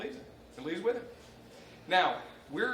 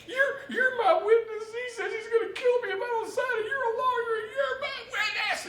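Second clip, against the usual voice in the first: high, frantic near-tearful pleading; hammed-up hysterical wife, much higher; panicked plea; 4 dB louder than usual. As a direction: beg the lawyer for help, voice cracking high. Panicked wife grabs the lawyer.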